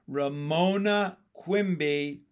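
a father's warning; stern. A narrator's reading. Father sternly warns Ramona, slow and firm. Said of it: "her father said sternly".

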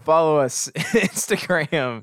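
cracking up mid-plug, words drawn out; laughing plug. Real, not acted. Podcaster plugging the show's socials, laughing. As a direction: try to get the plug out through laughter.

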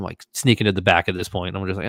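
ordinary talk, nothing marked

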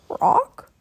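very high questioning squawk; raven croak. A narrator's reading. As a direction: croak it like a bird.

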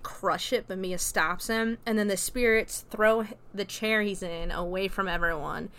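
ordinary talk, nothing marked

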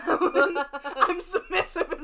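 slow, words broken up by laughing. Real, not acted. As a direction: crack up while saying it. Cracking up at the joke.

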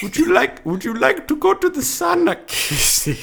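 very high-pitched put-on Finnish accent; mock Finnish accent. Finnish man inviting Casey to the sauna, mock Finnish accent. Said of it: "I'll be a fellow Finnish man".

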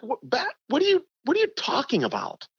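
high, disbelieving retold outburst; incredulous anger. Golfer retelling snapping at his mentor, incredulous. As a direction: fire back at him in mock outrage. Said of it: "now I'm pissed".

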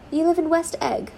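a hint of disdain for the place; contemptuous. A narrator's reading. Bored guest to the newcomer, mildly contemptuous. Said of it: "she remarked contemptuously".

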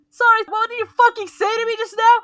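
high, loud indignant yell; mock outraged yell. Niece calling across the venue over music, outraged yell. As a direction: shout it across the room in disbelief. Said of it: "I'm like".

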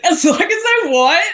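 high, indignant put-on voice; huffy diva voice; mock indignant. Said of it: "I was like".

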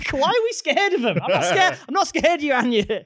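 high, jokey mock bravado; false bravado. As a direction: pretend not to be scared. Teasing boast to Cambridge grad.

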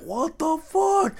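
slow, high, drawn-out disbelief; stunned disbelief. Retold or imitated. Ten-year-old watching the beating, slow high disbelief. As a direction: let the shock stretch each word.

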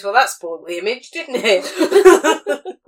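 put-on broad Norfolk accent, laughing; quoting in broad Norfolk. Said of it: "In a broad Norfolk accent".

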